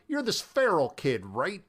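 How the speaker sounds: ordinary talk, nothing marked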